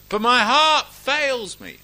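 slow, high, loud wail; hammed-up cry of dread; mock despair. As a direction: draw it out like a fainthearted complainer. Acts out a fearful believer's objection.

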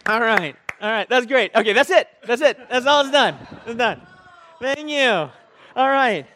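loud, high, laughing praise; loud, laughing real delight; laughing excitedly. Real, not acted. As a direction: cheer him off through laughter. Reacting to Josh's imitation walk.

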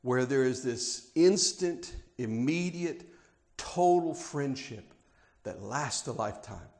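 tender, hushed sincerity. Real, not acted. Softened sermon opening.

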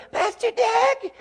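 put-on little boy's gruff voice, pitched very high; [name]. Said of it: "he's got this cutest little gruff voice".

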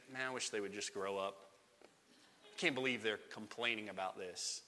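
hushed private disdain; scornful inner thought. Acted. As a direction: quietly roll your eyes at them. Inner thought judging grumblers.

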